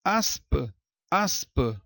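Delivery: "Aspa" sounded out as "As-pa"; split into syllables. Shown as a demonstration. Teacher showing S and P in different syllables, "Aspa" sounded out. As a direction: separate S from P in "Aspa", then repeat. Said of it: "o P na sílaba posterior".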